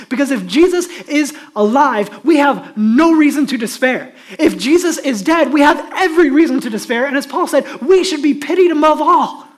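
loud, high, impassioned sermon voice; full-force preaching. Real, not acted.

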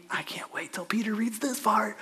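hushed, half-whispered glee; gleeful aside. Acted. Imagines John gleeful about Peter reading.

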